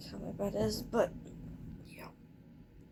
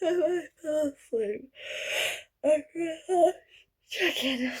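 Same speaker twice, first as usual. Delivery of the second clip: higher, louder, laughing through it; 7 semitones higher, 6 dB louder, slower than usual. Real, not acted. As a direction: laugh at your own slip. Embarrassed morning confession.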